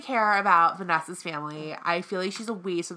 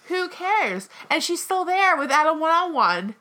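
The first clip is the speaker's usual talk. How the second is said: high, heated indignant outburst; worked-up, dismissive protest; 8 semitones higher, 5 dB louder than usual. Real, not acted. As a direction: fire back, pitched well up. Heated argument over contestant.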